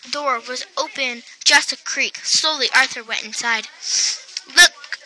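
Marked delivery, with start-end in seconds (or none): 4.6-5.0 sudden high call; excited cry. A narrator's reading. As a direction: cry out to the others. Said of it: "cried Buster".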